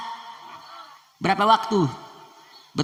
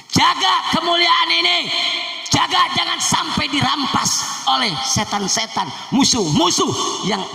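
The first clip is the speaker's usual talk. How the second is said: shouted exhortation; 11 semitones higher than usual. Acted. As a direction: burst out commanding the crowd. Preacher's exhortation.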